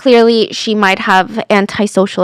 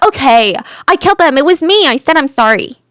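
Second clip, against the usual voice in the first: higher-pitched, sudden outburst; blurted confession; 4 semitones higher than usual. Retold or imitated. Suspect confessing to police out of nowhere, blurted. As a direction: blurt it out all at once, as if cracking. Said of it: "she blurts out".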